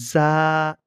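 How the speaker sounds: "জা" with buzzing z, held long; demonstrated Arabic letter. Shown as a demonstration. Teacher models the letter, buzzing drawn-out "জা". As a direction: buzz the z, then stretch "জা". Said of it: "কী করতে হবে".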